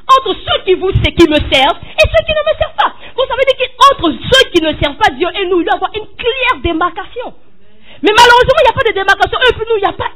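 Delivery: high, loud pulpit cry; preacher crying out in dismay; impassioned preaching. Acted. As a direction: lament it to the congregation. Preacher rising passion.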